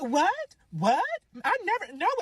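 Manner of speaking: high-pitched, put-on shocked denial; mock innocent denial. Mimics the rival's denial.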